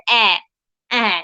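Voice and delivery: অ্যা as an open a sound. Shown as a demonstration. Teacher showing students the first English vowel sound অ্যা. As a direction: open the mouth wide for অ্যা. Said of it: "দুই রকম pronunciation".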